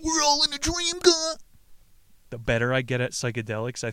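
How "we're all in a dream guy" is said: very high, loud silly cry; mock proclamation. Mocking what he is not saying, very high loud cry. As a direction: squeal it out as a mock revelation.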